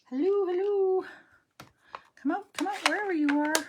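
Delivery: high, loud singsong call. Pretend. Crafter calling to a lost stamp, high singsong. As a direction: coax it out like hide and seek.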